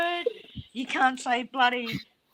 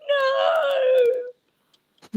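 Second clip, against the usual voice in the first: dismayed shout. Retold or imitated. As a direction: cry out in mock dismay. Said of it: "and I was like".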